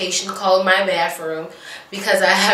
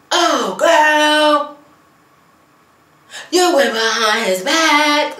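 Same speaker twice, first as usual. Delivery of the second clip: high, loud, slow mock outrage; hammed-up scandalized gossip; 8 semitones higher, 4 dB louder, much slower than usual. Vlogger mimics Queen's exaggerated shock to Jocelyn. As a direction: push the gossip over the top. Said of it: "she's like".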